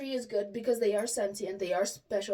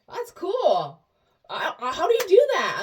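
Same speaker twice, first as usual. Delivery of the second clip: higher, louder excited praise; 8 semitones higher, 9 dB louder than usual. Retold or imitated. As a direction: gush with amazement. Hairdresser amazed by intuition.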